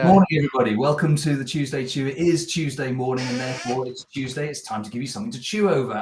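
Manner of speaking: loud, upbeat host welcome; hearty good morning to the viewers; show opener. Real, not acted. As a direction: open the show with a big, projected greeting. Opens the live show.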